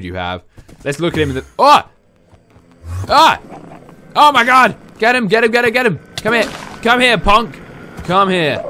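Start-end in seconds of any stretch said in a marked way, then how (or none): 1.6-8.6 high, loud frantic shouts; panicked yelling. Real, not acted. Player ambushed by Enderman, frantic loud yells. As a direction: scream while fighting off the mob.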